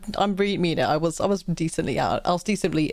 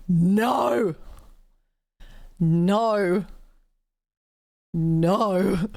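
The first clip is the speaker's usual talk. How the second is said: drawn-out, emphatic no, acted out; mock terrified refusal; much slower than usual. Refusing a friend's offer of introduction, mock-terrified. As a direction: refuse in mild mock horror. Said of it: "I was terrified".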